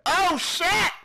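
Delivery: sudden high yelp of fright; startled yelp. Real, not acted. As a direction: jump at the scare and yelp. Jump scare in horror game.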